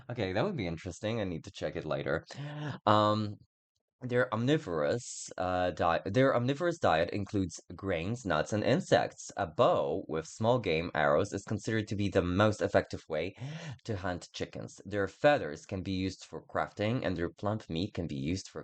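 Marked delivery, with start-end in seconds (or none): none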